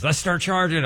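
lightly voiced eager friend, pitched high. Friend urging to charge the boxing students, eager high voice. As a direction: toss it off as the keen friend's pitch. Friend's business idea quoted.

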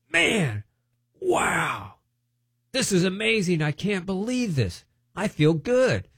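high, airy amazement; amazed. Retold or imitated. Amazed man after deliverance.

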